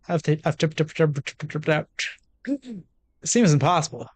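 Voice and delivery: normal talking voice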